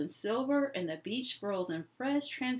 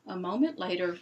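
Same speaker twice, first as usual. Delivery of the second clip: ordinary delivery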